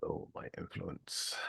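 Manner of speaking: whispering the words to himself; whispered. Real, not acted. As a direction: breathe it out, unvoiced. Repeating the request quietly.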